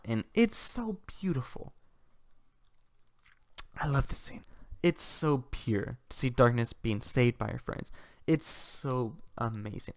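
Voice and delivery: soft, slow, almost whispered gushing; moved, hushed awe. Real, not acted. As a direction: savour it, overcome by how lovely it is. Moved by a favourite scene.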